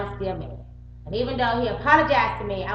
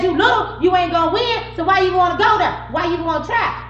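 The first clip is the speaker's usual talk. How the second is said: loud, higher imitation of his taunt; mock taunting; 8 semitones higher, 7 dB louder than usual. Quoting the boyfriend taunting her in the truck, loud and higher. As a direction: sneer it back as he said it. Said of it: "He said".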